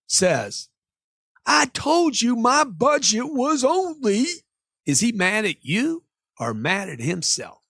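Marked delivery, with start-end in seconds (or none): 1.5-4.3 high, drawn-out mock complaint; hammed-up upset customer, stretching the words; emotional customer outburst. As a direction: whine it slowly as an exasperated buyer. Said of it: "Why is he getting all emotional?".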